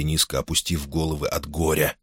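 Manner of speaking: ordinary delivery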